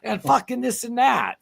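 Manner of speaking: high, loud sneering mock whine; shrill sarcastic parody of protesters; mocking whiny protester. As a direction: mimic a pampered brat screaming Nazi. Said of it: "So they go".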